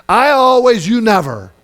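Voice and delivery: high, loud accusing jab; mock accusation. Husband joking with Michelle, mock accusing tone. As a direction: blame your partner with a sing-song jab. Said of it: "I always joke with Michelle".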